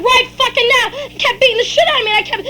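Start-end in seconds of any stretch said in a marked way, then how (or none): 0.1-0.8 raised, higher angry voice; angry retort. Acted. Father answering child mid-beating, angry retort. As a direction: punch it out as a threat.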